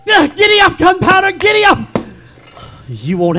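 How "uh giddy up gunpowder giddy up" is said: loud, higher cries to a horse; urgent command. Acted. Ichabod urging Gunpowder away from the specter, loud and urgent. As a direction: spur the horse on with raised voice.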